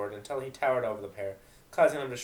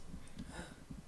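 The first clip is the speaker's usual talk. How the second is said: sharp gasp; 16 dB quieter than usual. Acted. Mare gets an idea.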